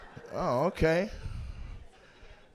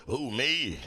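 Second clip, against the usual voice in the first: smoker woman; 4 semitones lower, 3 dB quieter than usual. Retold or imitated. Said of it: "Stop smoking".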